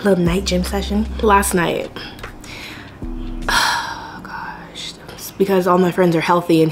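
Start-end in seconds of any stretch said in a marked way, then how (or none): 1.9-4.9 very soft, whispered sigh. Real, not acted. Vlogger recalling last night, sighed in a whisper. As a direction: let it out on a weary breath.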